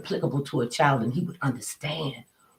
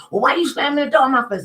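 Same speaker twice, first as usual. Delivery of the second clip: high, loud, indignant question; outraged that the door got slammed; offended outburst; 9 semitones higher, 5 dB louder, faster than usual. Retold or imitated. Neighbour protesting the door slammed in her face, indignant.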